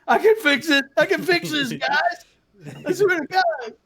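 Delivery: high, frantic reassurance; pleading in a high panic; panicked high voice. Real, not acted.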